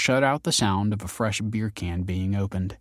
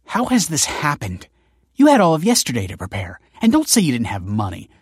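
quick, raised, accusing tone; snapping; 4 semitones higher, 6 dB louder than usual. A narrator's reading. Kelsey scolding her ex-foster father, snapping. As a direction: snap questions and accusations at him. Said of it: "snapped Kelsey".

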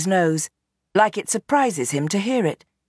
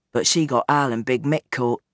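lower, weary father pleading his case; old gangster Bernie; 4 semitones lower than usual. Acted. Gangster father explains himself to his daughter, low and weary. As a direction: sound like a tired old crime boss.

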